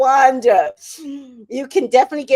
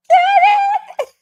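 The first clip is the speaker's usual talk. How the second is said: squealing through tears of laughter; crying with laughter; laughing scream; 6 dB louder than usual. Real, not acted. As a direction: shriek the name while cracking up.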